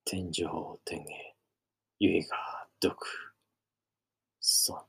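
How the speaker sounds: very slow, faint whispered last words; dying whisper. Acted. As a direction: breathe out the final words as life fades.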